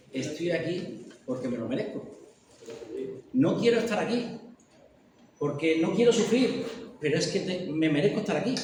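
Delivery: normal talking voice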